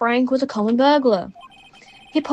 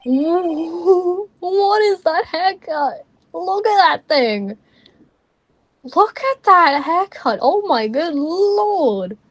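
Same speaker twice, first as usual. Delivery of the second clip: drawn-out, higher-pitched disbelief; slow, stretched-out amazement at a ridiculous sight; aghast gawping; 5 semitones higher, much slower than usual. Real, not acted. Player gawping at a character's haircut, aghast.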